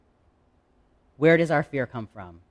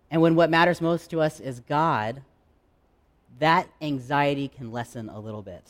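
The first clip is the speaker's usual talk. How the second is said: ordinary talk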